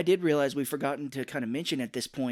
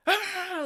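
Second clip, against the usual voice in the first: high, loud pretend scream; mock scream; 5 dB louder than usual. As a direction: fake being eaten alive, squealing. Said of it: "Like he's getting eaten by the toaster".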